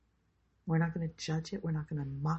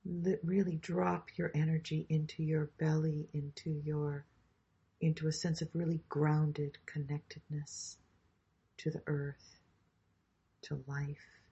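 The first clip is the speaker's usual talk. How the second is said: guided meditation; 2 semitones lower than usual. Acted. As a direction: guide them gently into the body. Leads a grounding meditation.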